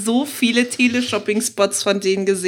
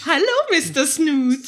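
teleshopping parody voice; 4 semitones higher than usual. Retold or imitated. Answers the Switch parody.

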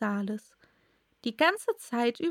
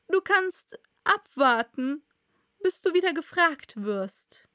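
higher, louder royal rebuke; tadelnder König; 6 semitones higher, 4 dB louder than usual. Acted. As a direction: put the witness in place as king. King rebukes the Hatter. (tadelnder König, laut und hoch)

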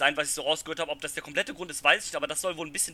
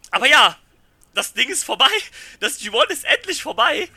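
excited; 8 semitones higher, 8 dB louder than usual. Real, not acted. Cheering the end of the tournament.

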